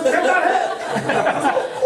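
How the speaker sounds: shrieking high yell of a defiant daughter; daughter's yell. Retold or imitated. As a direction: scream it back at mom. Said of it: "막 이러면서". (엄마에게 대드는 딸, 높은 비명)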